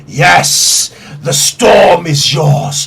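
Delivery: hushed yes swelling into a slow proclamation, then sinking; gloating, drawn-out divine voice; storm deity. Acted. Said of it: "as you hear".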